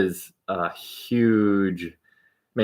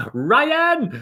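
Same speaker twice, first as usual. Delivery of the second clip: high, loud drawn-out call of "Ryan!"; a loud, high, falling "Ryan!"; all-caps Ryan shout; 5 dB louder than usual. Pretend. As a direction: belt the name out like calling a buddy across the room. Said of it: "every time you say Ryan in all capital letters, I just have to just say it".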